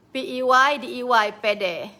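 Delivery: "P E Y D E Y" loud, then "pede" falling; spelled then said. Shown as a demonstration. Host spelling out pede for viewers. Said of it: "Patutnya kita sebut pe dengan de".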